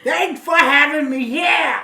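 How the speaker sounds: high, loud grateful yell; loud Gilbert Gottfried. Acted. Comedian thanking the talk-show host, yelling. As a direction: shout your thanks far too loud. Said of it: "he would be like".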